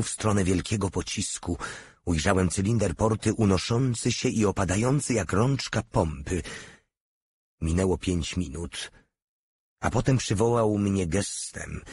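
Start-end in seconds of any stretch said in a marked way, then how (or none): none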